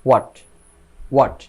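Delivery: হোয়াট said as "wat", no h; correct pronunciation. Shown as a demonstration. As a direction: start হোয়াট straight on the w, one short syllable. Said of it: "এটার সঠিক উচ্চারণ হবে".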